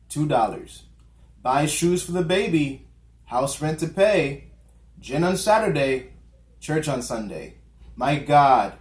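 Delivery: measured poem reading, a pause after each line; poem recitation. Acted. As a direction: recite verse in short falling phrases, leaning on key words. Reads the poem aloud.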